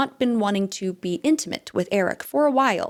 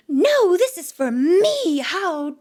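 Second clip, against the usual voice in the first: slow, loud, higher-pitched outraged imitation; hammed-up outrage, drag out the key words; mock indignation; 7 semitones higher, 3 dB louder, slower than usual. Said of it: "And now you're like".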